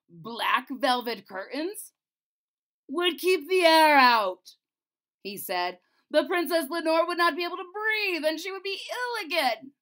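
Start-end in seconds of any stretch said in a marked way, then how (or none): 0.1-4.6 slow, loud, angry king; the king rages at the wizard, slow and loud. A narrator's reading. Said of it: "The king was so angry". 6.1-9.7 high, loud angry outburst; the king rages at the wizard. A narrator's reading. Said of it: "The king was so angry".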